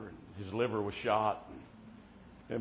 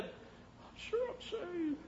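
high, slow, faint frail voice; a dying man calmly sure; weak little voice; 8 dB quieter, much slower than usual. Retold or imitated. Dying friend answering the preacher, slow and faint. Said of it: "this little voice".

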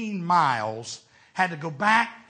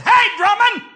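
calling out; 13 dB louder than usual. Retold or imitated. Holy Ghost calls to the preacher.

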